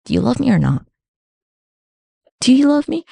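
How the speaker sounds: God asking Abraham lightly, barely voiced; a hint of a needy God; God asking Abraham for proof of love, lightly voiced; mild needy God. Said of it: "And he was like".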